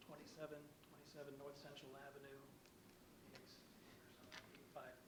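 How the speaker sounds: soft, fading away from the mic; mumbling off-mic, barely audible; too quiet. Real, not acted. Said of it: "Could you speak up, please, or down in the microphone?".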